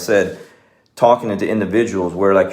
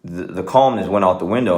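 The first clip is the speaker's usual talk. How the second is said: ordinary delivery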